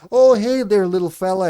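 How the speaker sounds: high, loud cooing at a tiny kitten; a bright "hey there" to a surprise kitten. Retold or imitated. Streamer greeting the new kitten, high and loud cooing. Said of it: "And I was like".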